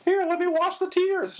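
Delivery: high teasing clown offer; mock clown voice. Clown offering to wash her tears, high mock voice. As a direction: play the clown squirting her. Voicing clown with water flower.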